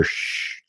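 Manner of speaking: soft voiceless shush; quiet "shh". Retold or imitated. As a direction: hush someone on the sly with a long shh. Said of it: "and I gesture".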